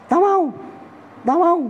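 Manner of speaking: very high coy refusal; coy young cat. Acted. Preacher voicing the maiden cat turning the tomcat down. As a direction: squeal it out like a shy young she-cat. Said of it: "dijawab sama kucing yang perawan".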